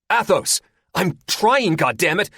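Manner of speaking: high, loud frustrated yell; angry shout. Acted. Gunner shouts back at the pilot in frustration. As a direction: snap back, stressed. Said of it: "Athos shouts".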